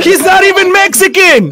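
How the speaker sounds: high, loud laughing protest; very high, loud, laughing outburst. Real, not acted. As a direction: crack up while shouting it.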